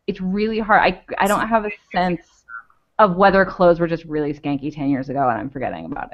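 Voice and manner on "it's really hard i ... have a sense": normal talking voice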